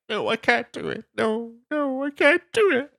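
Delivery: very high mock-panicky squeal; squeaky, hammed-up protest; reluctant whiner. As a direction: mimic a reluctant refuser in a silly high voice. Mimics the one reluctant person.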